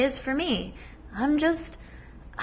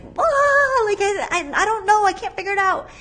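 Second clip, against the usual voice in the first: much higher, louder panicked squeal; 10 semitones higher, 5 dB louder than usual. Retold or imitated. On the phone with her partner, panicked high squeal. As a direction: squeal it out like you're losing it. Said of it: "And I was just like".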